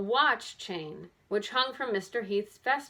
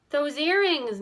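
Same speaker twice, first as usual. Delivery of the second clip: higher and louder startled cry; excited exclaim; 7 semitones higher, 6 dB louder, slower than usual. A narrator's reading. Nancy spots the earrings in the photo, exclaiming. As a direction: recognize them in a flash. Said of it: "Nancy exclaimed".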